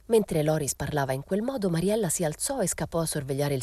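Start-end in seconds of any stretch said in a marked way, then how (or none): none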